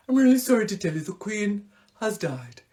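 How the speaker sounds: a hint of a solemn Welsh newsreader. As Hugh Edwards breaking the Queen's death, lightly voiced solemn newsreader. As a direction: read the sad news gravely. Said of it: "he's like".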